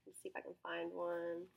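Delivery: ordinary talk, nothing marked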